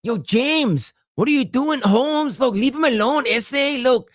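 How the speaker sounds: high, exaggerated Chicano vato accent; crazy LA vato. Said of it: "like a crazy vato from L A".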